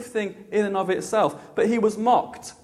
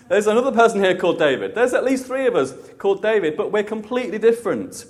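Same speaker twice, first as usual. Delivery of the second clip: quicker, louder, laughing repeat; amused laughing; 3 semitones higher, 3 dB louder, faster than usual. Real, not acted. Preacher repeats himself to the congregation after a joke, laughing. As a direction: say it through a real laugh.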